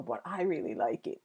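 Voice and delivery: dropped, confidential undertone; hushed near-whisper. Real, not acted. Streamer admitting a favorite game to Grace, hushed. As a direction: confide it low and soft. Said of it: "I don't know why I'm whispering".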